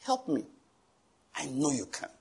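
soft, quiet plea; soft whispered-down plea to the Lord; hushed humble prayer. Shown as a demonstration. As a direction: pray it softly on your knees. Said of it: "get on your knees there and say".

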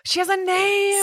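high-pitched delighted cry; excited cheer. Real, not acted. Co-host cheers that Susan has a name, excited. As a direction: celebrate it like big news. Celebrates wife being named.

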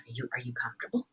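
low, very soft, quick check-in; hushed question. Retold or imitated. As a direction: ask discreetly so nobody else hears. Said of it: "trying to communicate to her very quietly".

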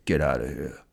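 Rambo voice. Retold or imitated. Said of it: "He says".

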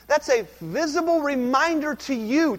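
usual speaking voice

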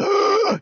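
high, drawn gasp; mock gasp. Comedian imitates the punched nun, a mock high gasp. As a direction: gasp as if caught off guard. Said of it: "and she's like".

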